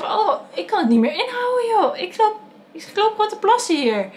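high, strained mock alarm; retold panic. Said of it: "Ik zeg". (hoge, geschrokken nagespeelde paniek)

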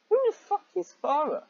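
high, incredulous yelp; squeaky outraged who-is-that; baffled outburst. Real, not acted. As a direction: shoot the pitch way up in disbelief. Baffled outburst reading fanfic.

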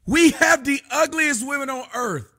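normal talking voice

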